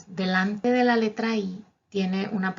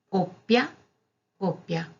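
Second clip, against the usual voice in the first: "Copia" with a held pp, then "copia" with a single short p; double p contrast; slower than usual. Shown as a demonstration. Teacher contrasting the two copias for the class. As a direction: hold the double consonant on the first only. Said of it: "si digo copia".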